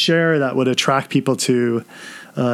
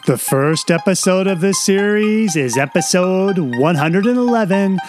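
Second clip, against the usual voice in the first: higher-pitched announcer read; podcast host intro; 5 semitones higher than usual. Acted. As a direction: introduce the series like a show host. Reads the show intro.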